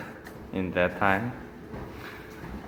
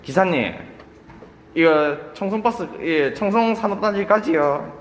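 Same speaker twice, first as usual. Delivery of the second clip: rapid Busan dialect Korean, higher; Busan accent; 8 semitones higher, much faster than usual. Retold or imitated. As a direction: rattle it off fast in Busan dialect. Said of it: "as a Busan people way".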